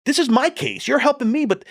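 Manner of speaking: high, pleading, panicked rant; frantic complaint. Retold or imitated. Rookie calling the county detective after the raid, high panicked pleading. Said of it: "I said".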